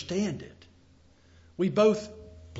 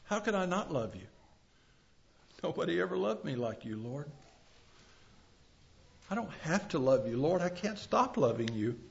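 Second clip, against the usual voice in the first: softer, quick, intimate words to the Lord; tender prayer; 6 dB quieter than usual. Acted. Believer softly telling the Lord he loves him. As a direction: murmur your love straight to God.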